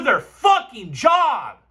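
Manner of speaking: slow, hammered shout; angry-hyped finish; fired up. Real, not acted. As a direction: punch out every word. Fired up rant about centers.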